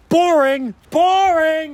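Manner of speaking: high, drawn-out jeer; heckling call. Acted. Mock outburst heckling the repetitive book.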